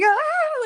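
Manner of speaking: sudden high, loud scared scream; retold scream. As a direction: shriek as if a knock on the window startled you. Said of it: "I literally scream".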